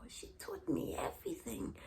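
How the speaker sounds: whispered memory. Real, not acted. Tender whisper about mother.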